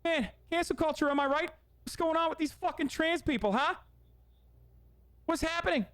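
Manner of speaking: lightly lampoon a comic griping on stage; a hint of a comedian's hammed-up bit; mock Bill Burr. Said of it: "his special was all like".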